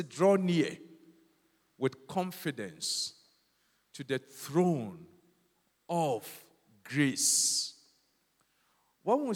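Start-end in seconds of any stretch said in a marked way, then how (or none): 0.0-7.7 slow, weighty scripture reading; scripture quoted slowly. Acted. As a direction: let each phrase of the verse land. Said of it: "Or say".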